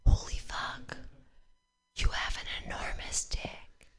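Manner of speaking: hushed, awed whisper; a whispered gasp at the size; stunned whisper. Retold or imitated. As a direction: breathe it out in shock. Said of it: "And I was like".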